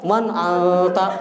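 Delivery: "Man anta" slowly recited with a long nasal n in anta; chatting with tajwid. Shown as a demonstration. Teacher imitating someone chatting in tajwid, nasal ikhfa. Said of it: "kalau antum pakai tajwid ngobrol".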